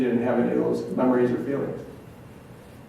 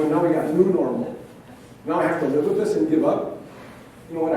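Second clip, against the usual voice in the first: ordinary talk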